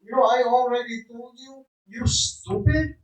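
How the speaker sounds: loud high-pitched scolding yell; mother screaming. Retold or imitated. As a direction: scream it down at your child like an angry mother. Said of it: "screaming me and putting me down".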